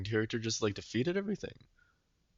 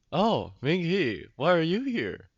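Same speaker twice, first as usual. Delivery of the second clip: doctor role; 6 semitones higher, 4 dB louder, slower than usual. Retold or imitated. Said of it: "The doctor's like".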